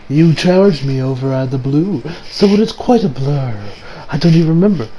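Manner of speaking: lightly voiced villain pretending to think; taunting villain. Kira toying with Josuke over the blur, taunting villain. Acts out Kira feigning forgetfulness.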